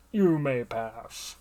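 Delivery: slow, drawn-out, loud guard voice; guardsman voice. Acted. Guardsman letting a traveller into the village, slow booming role voice. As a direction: draw the words out loudly as a gatekeeper. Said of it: "That's not how I picture him talking".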